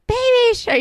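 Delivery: high, loud mock kid shout; excited little kid. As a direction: imitate a child calling out for a song. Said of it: "Irving's like".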